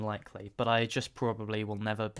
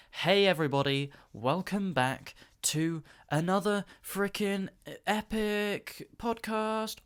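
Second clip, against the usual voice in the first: silly intro voice; 7 semitones higher than usual. Pretend.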